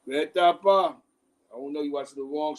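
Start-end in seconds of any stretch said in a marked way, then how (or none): 0.0-0.9 loud, exasperated call of the name. Real, not acted. To a viewer getting his story wrong, a loud exasperated call. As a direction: call him out, fed up.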